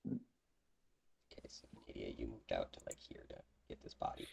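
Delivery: soft unvoiced aside to a player; whispered aside. Real, not acted. GM quietly checking with Nadia, whispering. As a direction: whisper the table note.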